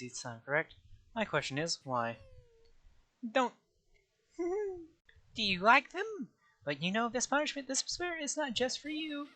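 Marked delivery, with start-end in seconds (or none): 4.4-4.8 high-pitched gleeful cartoon cackle. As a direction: cackle like a mischievous mascot. Monokuma laughs.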